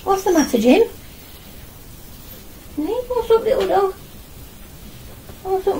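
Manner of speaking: usual speaking voice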